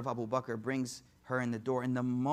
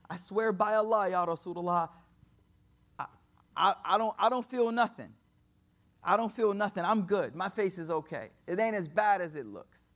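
raised, emphatic protest that all is fine; insistent reassurance; 4 semitones higher, 6 dB louder than usual. Retold or imitated. Injured friend to weeping Prophet, insistent reassurance. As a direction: insist loudly, retelling a hurt man's brave words.

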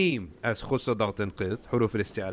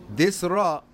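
ordinary talk

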